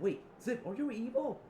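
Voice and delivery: high, loud, startled question; shocked question. Acted. Asking Zip if he turned evil, shocked and high. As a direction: cry it out in alarmed disbelief. Acted alarmed question to villain.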